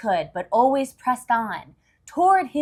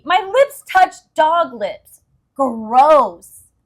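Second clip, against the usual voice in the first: high, loud, slow grossed-out wail; disgusted cry; 5 semitones higher, 10 dB louder, much slower than usual. Acted. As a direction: wail it like you kissed a dog. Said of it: "cried Lucy".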